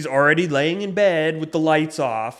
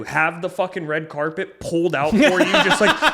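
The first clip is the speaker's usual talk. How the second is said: higher, louder exasperated venting with a laugh; retold angry rant; 7 semitones higher, 6 dB louder than usual. Ranting to his girlfriend about waiting outside the shower, exasperated. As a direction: vent the frustration half laughing. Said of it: "'cause now I'm out there fuming mad".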